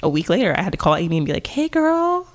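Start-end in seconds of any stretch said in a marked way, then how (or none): 1.5-2.3 squeaky sing-song apology to a friend; calling a friend to admit she was right, high and sheepish; high sheepish girly voice. Retold or imitated. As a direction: pitch way up, sheepish and cute.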